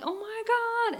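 put-on great-grandma gushing over the baby; mock doting grandma. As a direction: gush like a charmed great-grandma. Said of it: "My great-grandma goes in there like".

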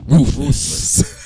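usual speaking voice

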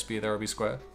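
ordinary delivery

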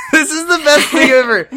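high-pitched, delighted laughing; cracking up while talking. Real, not acted. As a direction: say it through rising giggles. Breaks up laughing over favorite scene.

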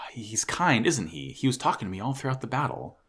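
soft sighing near-whisper; tender hush. Retold or imitated. Injured girl talking about her mysterious guide, hushed. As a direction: breathe it out softly, in wonder. Soft acted line of character.

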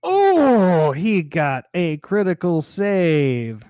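loud, high "oh", then drawn-out words; excited announcement. Real, not acted. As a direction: call out a stunning die roll slowly. Reacts to a critical die roll.

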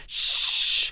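hushing whisper; a sharp voiceless shush. A narrator's reading. Hushing a talkative monk.

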